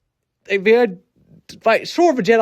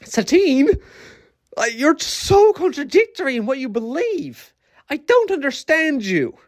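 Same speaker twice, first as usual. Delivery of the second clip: exasperated disbelief; 6 semitones higher than usual. Real, not acted. Addressing Satine in disbelief.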